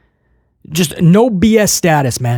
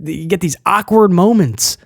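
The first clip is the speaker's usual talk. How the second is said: ordinary talk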